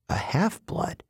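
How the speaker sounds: "half-blood" rising into a quiet question; stunned echo. A narrator's reading. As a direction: repeat "A half-blood" softly, taken aback.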